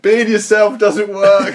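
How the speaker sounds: high, slow, drawn-out yell; mock shouted outburst. Comedian turns on his friend in the scene, hammed-up slow yell. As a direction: belt it out, stretching each word. Imagined retort acted to friend.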